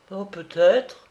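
high, tentative little answer; woman witness. A narrator's reading.

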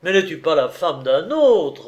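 loud, harsh retort; a man coldly reproaching a married woman, harsh and loud. A narrator's reading. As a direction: throw it back at her coldly. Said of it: "repartit durement Vladimir".

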